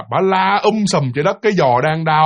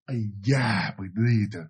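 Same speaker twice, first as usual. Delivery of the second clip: low, soft moan of pain; aching groan; 8 semitones lower, 9 dB quieter than usual. Acted. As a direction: groan quietly over a sore leg. Acting out sore-legged woman groaning.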